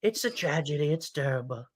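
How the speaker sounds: Trump-style rally riff, repetitive and emphatic; Trump impression. Mocking right-wing talking points in a Trump impression. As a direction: do Trump, bragging and outraged by turns.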